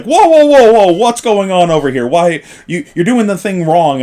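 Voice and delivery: loud, high whoa, whoa; mock alarmed judge. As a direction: play the judge barging in, alarmed. Judges rushing over alarmed.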